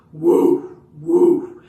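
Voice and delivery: loud, high mock barking; mock dog bark. Leads kids in barking along, loud mock woof. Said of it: "Let's do it together".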